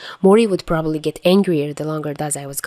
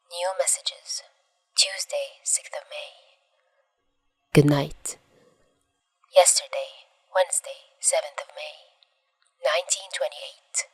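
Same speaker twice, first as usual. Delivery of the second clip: whispered message header; 6 dB quieter than usual. A narrator's reading. Reads phone message headers.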